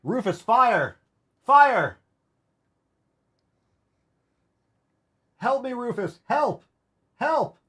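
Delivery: high-pitched fake distress calls; mock cries for help. Owner testing Rufus with fake fire, mock pleading cries. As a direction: pretend panic, calling the dog to the rescue. Pretend emergency for the dog.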